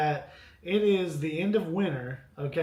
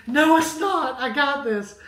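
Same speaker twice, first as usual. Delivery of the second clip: high, loud retort; defiant insistence; 8 semitones higher, 5 dB louder than usual. Retold or imitated. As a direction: insist you have it handled. Insisting to child fire is handled.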